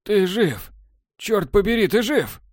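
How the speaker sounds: high, excited cry of disbelief; overjoyed disbelief. A narrator's reading. Borland finding Technar alive, high joyful cry. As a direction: burst out in amazed joy.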